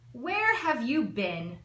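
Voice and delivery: loud and slow, booming demand from big aunts; big blustery voices. Acted. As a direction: boom the question down slowly. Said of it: "in their big blustery voices".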